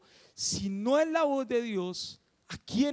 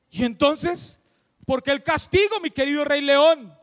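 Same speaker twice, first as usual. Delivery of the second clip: louder, indignant questioning; loud protest; 3 semitones higher, 8 dB louder than usual. Acted. Tiger questioning the lion king's punishment, protesting. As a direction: demand an explanation, pushing the volume up.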